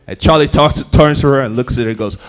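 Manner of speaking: ordinary talk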